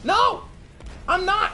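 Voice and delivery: shouted high no; high indignant shout; loud high refusal. Real, not acted. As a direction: yell it, fully fed up. Heated refusal in rant.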